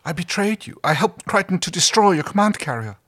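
high-pitched, emphatic confession; anguished confession. Acted. Harvey confesses betrayal to Scorpius, anguished.

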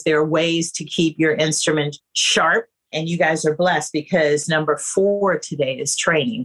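usual speaking voice

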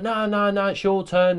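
high, insistent retold protest; mock squabbling. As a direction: bat it straight back in a playful re-enacted squabble. Retold squabble over a rod.

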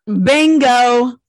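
loud, long-held "bingo"; emphatic agreement. Real, not acted. Co-host seizing on the payment question, loud drawn-out bingo. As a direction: stretch "bingo" out loud and high.

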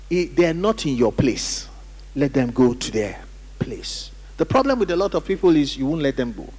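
normal talking voice

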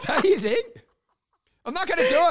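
giggling it out; laughing while insisting to his friend. Real, not acted. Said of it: "laughing while talking".

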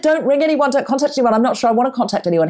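high, urgent mock outrage; reliving the panic of telling him to stop; retold furious warning. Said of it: "I was just, like, apoplectic".

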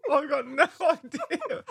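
laughing through the words; giggling, can barely talk. Real, not acted. Laughing at a water mishap.

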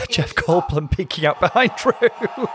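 high, slow words through a laugh. Real, not acted. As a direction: say it while cracking up. Laughs at an odd sight onstage.

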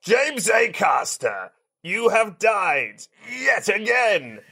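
slow, booming host announcing the afterlife; a grand gatekeeper between heaven and hell, drawn out; Judgment Day host. Acted. Podcast host greets guest as Judgment Day judge, booming and slow.

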